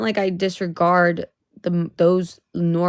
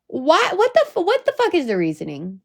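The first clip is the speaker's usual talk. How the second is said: exasperated plea to God; 9 semitones higher than usual. Retold or imitated. Asking God why the date, high and outraged. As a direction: throw it up to the sky, outraged. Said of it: "And then I be asking God".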